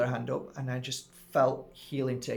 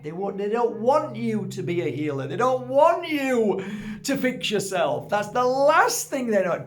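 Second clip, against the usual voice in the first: higher and louder, slowing into a rant; getting carried away on a passionate rant; going off on one; 7 semitones higher, 6 dB louder than usual. Real, not acted. Healer ranting to his audience, higher, louder and heated. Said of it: "don't go off on one".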